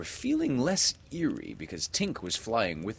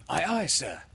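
put-on high-pitched boy's sailor reply; high, quick childlike compliance; obedient boy; 10 semitones higher, 3 dB louder than usual. A narrator's reading. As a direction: repeat it high, like a boy obeying his captain. John repeats it to Peter.

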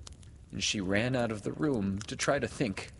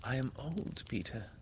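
soft, reluctant admission; soft confession; 4 semitones higher, 7 dB quieter than usual. A narrator's reading. Wendy has to tell Peter she is old, softly. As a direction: confess it gently and quietly.

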